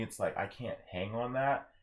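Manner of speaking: usual speaking voice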